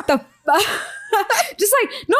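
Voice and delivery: high, helpless laugh-crying. Real, not acted. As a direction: crack up laughing, pitch up high. Laughing hard at the clip.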